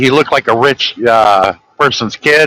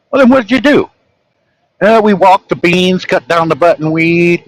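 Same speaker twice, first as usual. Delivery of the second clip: mock voices in dialogue; 7 semitones higher than usual. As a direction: pitch up the dad question and son's reply. Retold dialogue with son.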